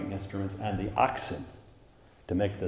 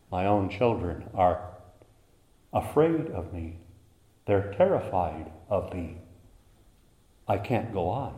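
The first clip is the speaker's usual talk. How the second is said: tender, grieved. Acted. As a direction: let the anger melt into sorrow. God voiced, tender after climax.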